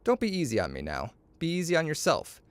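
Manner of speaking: ordinary delivery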